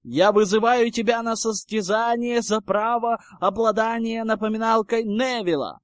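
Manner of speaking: loud, high formal proclamation; yelled. A narrator's reading. Harry challenging Goyle to a contest, proclaiming loudly. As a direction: declaim the challenge at a sustained pitch. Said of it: "завопил Гарри".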